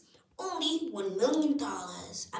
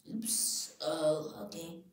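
ordinary talk

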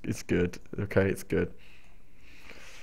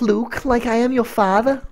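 high-pitched comic Scouse movie line; Scouse accent; 12 semitones higher than usual. Retold or imitated. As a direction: Darth Vader gone Scouse, pitched way up. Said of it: "films were done in a Scouse accent".

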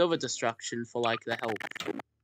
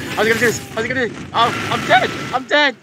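high, loud frantic yelling; panicked shouting; 11 semitones higher, 12 dB louder, faster than usual. Real, not acted. Player losing the battle, high panicked shouting. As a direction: yell in a game panic as it all falls apart.

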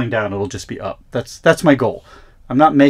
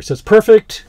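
excited; 10 semitones higher, 8 dB louder than usual. Real, not acted. Pleased with the fix.

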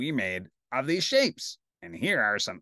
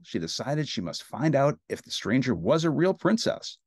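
usual speaking voice

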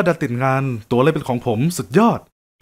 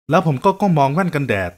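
ordinary delivery

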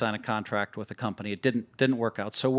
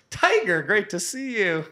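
higher, louder surprised greeting, slower at the end; excited; 9 semitones higher, 4 dB louder, slower than usual. Retold or imitated. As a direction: greet a hero you didn't expect. Surprised greeting to Tiger Woods.

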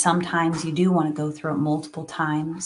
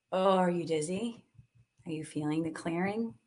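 ordinary talk

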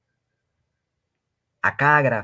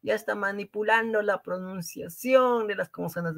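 mimicked carping critics; 8 semitones higher than usual. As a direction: whine it like a nitpicker objecting. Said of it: "por ahí van a decir".

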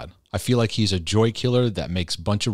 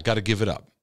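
resigned self-denial. Acted. Voices the guilty inner reaction.

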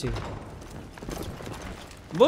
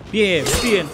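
mocking farewell; 3 semitones higher, 6 dB louder, slower than usual. Real, not acted. Taunting defeated general.

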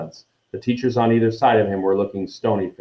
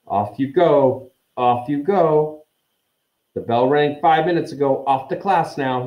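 higher-pitched, officious shooing; raised prefect's dismissal; Percy Weasley; 5 semitones higher than usual. A narrator's reading. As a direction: shoo the students along briskly. Percy shooing students away.

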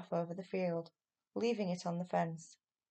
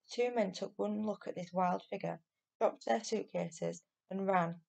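plain reading voice

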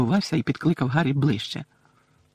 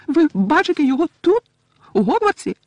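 very high, loud elf question; incredulous little elf squeal; Winky the house-elf, astonished; 6 dB louder than usual. Acted. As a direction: squeak with amazed hope.

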